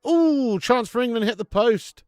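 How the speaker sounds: sudden high, loud burst of excitement; real match-watching yelp; excited shout. Real, not acted. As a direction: yell it as the ball hits the post. Live reaction to shot hitting post.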